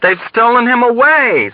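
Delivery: high, panicked cry; screamed alarm. A narrator's reading. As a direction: scream the news across the ward. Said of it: "screamed someone else".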